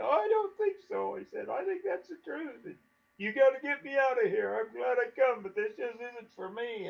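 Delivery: high, strained imitated begging voice; scared pleading. Scared hunter begging Dave to get him out, in a high pleading voice. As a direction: beg to be taken out, mock-frightened.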